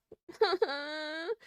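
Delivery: shaky laugh near tears; teary laugh. Real, not acted. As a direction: laugh close to crying.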